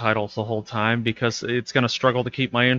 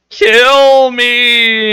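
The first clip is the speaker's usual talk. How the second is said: higher, louder mock shriek of kill me; mock scream; 10 semitones higher, 9 dB louder than usual. Retelling the llama man's last plea, mock shriek. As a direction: shriek it like the dying llama man. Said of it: "he just goes".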